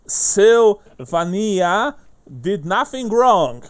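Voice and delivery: loud proclamation. Acted. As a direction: announce it slowly to the whole tavern. Lad reads note aloud.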